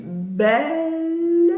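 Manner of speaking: "belle" as a single syllable with a long L; "belle" stretched slowly to demonstrate; one prolonged syllable. Shown as a demonstration. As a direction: hold the L of "belle" on and on. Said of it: "une syllabe qui se prononce".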